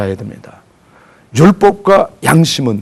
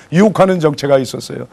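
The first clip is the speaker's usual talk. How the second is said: normal talking voice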